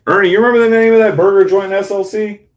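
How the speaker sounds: loud, high call to the next room. Real, not acted. Asking his brother across the room, a loud high call. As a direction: shout the question across the house.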